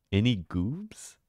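slow, drawn-out goofy voice on "Any goobs?"; playful slow goofy call to chat; silly put-on voice. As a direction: stretch "Any goobs?" slowly in a silly character voice.

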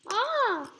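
sudden high loud yelp; a sharp yelp of alarm; startled cry as the character gets hit. Real, not acted.

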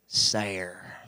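"Sair" for sour, one syllable rhyming with "hair"; "Sair" said quietly as the hillbilly form of sour; hillbilly pronunciation. Retold or imitated. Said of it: "how do you pronounce S O U R".

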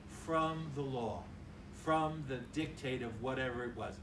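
ordinary delivery

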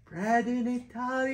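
lifted high, proclaimed to the sky; sky-high announcer call. Shown as a demonstration. Father proclaiming a word to his kids, sky-high. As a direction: announce it up to the sky like a game show. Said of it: "Put it up in the sky and say".

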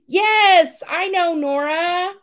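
very high, loud, gushing voice; gushing celebrity. Retold or imitated. Singer introducing the girl to the president, high and gushing. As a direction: gush sweetly over the girl.

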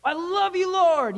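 high, loud heartfelt cry; loud passionate praise; crying out to God. Shown as a demonstration. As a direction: call out to the Lord with all your heart. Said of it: "You cried out with all your heart".

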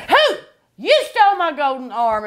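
put-on spring the scare on the kids; jump-scare shout. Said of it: "And she'd go".

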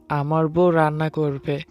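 usual speaking voice

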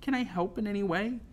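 high-pitched, earnest offer; small high voice; timid pleading. A narrator's reading. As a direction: offer help meekly. Narrator voices timid girl offering help.

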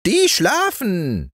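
very high, slow rooster voice; drawn-out crowing reply; rooster calling down. Acted. Golden rooster calling down to the boy, high and slow. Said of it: "rief der goldene Hahn herunter".

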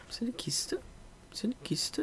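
searching whisper, almost secret. Real, not acted. Gamer whispering to herself while hunting a chest in a ruin. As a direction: hush it while looking for a chest.

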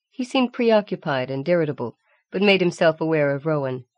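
ordinary delivery

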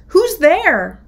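high, loud squeaky cry; frightened shriek. Acted. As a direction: yelp it in the dark. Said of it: "The mouse shrieked".

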